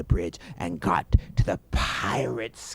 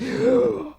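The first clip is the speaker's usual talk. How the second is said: sudden loud gasp; sharp high gasp; 6 dB louder than usual. Acted. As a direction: gasp in surprise. Gasp alone mid-narration.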